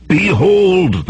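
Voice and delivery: high, drawn-out proclamation; crying out. A narrator's reading. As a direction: call out like a mage commanding a crowd. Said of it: "the red-robed mage cried".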